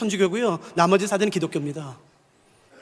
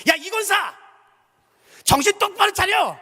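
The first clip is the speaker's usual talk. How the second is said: high, sharp call across the room; shouting; 10 semitones higher than usual. Retold or imitated. Deacon shouting at grieving mother at the funeral, high call. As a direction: snap her out of her numbness with a cry. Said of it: "큰 소리 이렇게 외쳤습니다".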